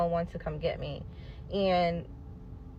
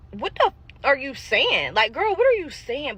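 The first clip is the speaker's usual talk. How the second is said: alarmed outburst; 7 semitones higher, 5 dB louder than usual. Retold or imitated. Alarmed by friend's chanting.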